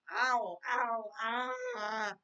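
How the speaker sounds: loud, high yelps into a drawn-out cry; pained outcry. Real, not acted. Viewer hit by a sudden loud noise, pained loud outcry. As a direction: cry out over a painful blast of noise. Reacts to painfully loud sound.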